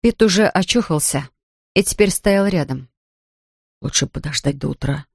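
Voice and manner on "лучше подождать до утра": lower, softer, voiced murmur; hushed near-whisper. A narrator's reading. Companion cautioning Lincoln by the dark forest, hushed. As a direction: drop to a lightly hushed, low voice. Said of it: "прошептал он".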